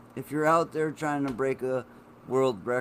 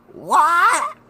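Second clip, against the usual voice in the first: shocked shout; 11 dB louder than usual. Retold or imitated. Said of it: "mom's reaction, woken up".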